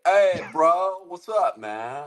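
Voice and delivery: put-on drawn-out California dude accent; Valley dude accent. Guy from California greeting a bro, Valley dude accent. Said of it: "That's a Valley dude accent".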